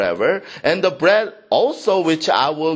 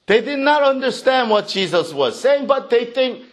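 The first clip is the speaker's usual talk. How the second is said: usual speaking voice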